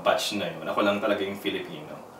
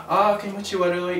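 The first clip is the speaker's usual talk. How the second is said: mocking schoolgirls; 9 semitones higher than usual. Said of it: "sumisigaw yung tatlong girls".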